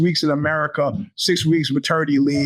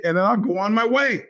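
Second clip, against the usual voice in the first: usual speaking voice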